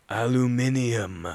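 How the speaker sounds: Aluminium in a hammed-up bad American accent, slow. A narrator's reading. British boyfriend mocking her, bad American accent. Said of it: "he answers in a bad American accent".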